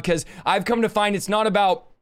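ordinary delivery

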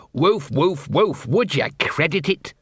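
barks spoken as words, in character; sarcastic talking dog. Acted. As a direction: woof wearily, then grumble like a put-upon dog.

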